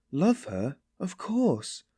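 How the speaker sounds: high, plaintive insistence; plaintive emphasis. A narrator's reading. Sonia insists that she loves her, plaintive. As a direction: answer emphatically, voice lifting plaintively. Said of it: "said Sonia with plaintive emphasis".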